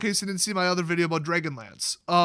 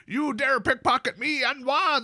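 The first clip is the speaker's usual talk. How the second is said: a hint of an indignant Morrowind dark elf, a bit higher; dark elf NPC; 5 semitones higher than usual. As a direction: lightly mimic a game elf scolding a thief. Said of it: "those dark elves would say it".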